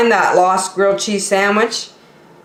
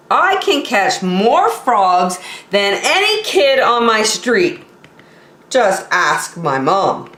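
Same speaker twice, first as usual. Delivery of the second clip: ordinary delivery, nothing marked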